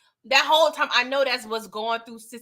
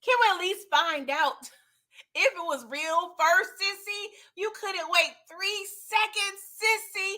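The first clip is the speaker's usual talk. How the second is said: high, whiny plea to Sissy; pleading complaint; 5 semitones higher, slower than usual. Retold or imitated. Viewer complaining to Sissy about the shooting, high whiny plea. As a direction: beg her to wait just a moment. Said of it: "And I'm like".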